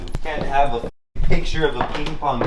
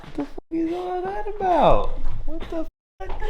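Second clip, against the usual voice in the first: high squeal of disbelief; shocked high exclamation. Real, not acted. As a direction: shriek it in surprise. Exclaims at strange room.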